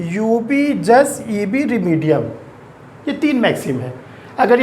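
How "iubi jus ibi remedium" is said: each Latin word spaced out very slowly for notes; slow dictation. Shown as a demonstration. Teacher slowly dictating the first maxim to students. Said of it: "और तीसरा maxim क्या है?".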